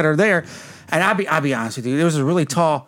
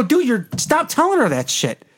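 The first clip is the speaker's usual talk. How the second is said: higher, drawn-out retold objection; mock indignant protest; 7 semitones higher than usual. Man tells cop off over crying Kelly, retold indignant protest. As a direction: plead firmly in a raised voice, slowly.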